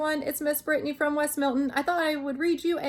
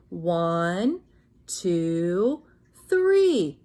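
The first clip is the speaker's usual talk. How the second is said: counting demo; 6 semitones lower, much slower than usual. Shown as a demonstration. Teacher showing children how to count while touching. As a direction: count along, landing hard on three. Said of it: "Let's practice one more time".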